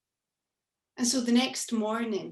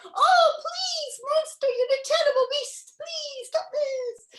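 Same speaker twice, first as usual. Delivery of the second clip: much higher hammed-up squeal of fake fright; mock terror; 4 dB louder than usual. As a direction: pretend to be terrified, overplayed. Granny fakes terror at the monster.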